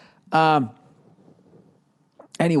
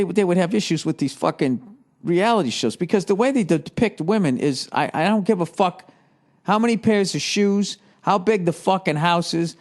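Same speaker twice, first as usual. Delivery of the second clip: normal talking voice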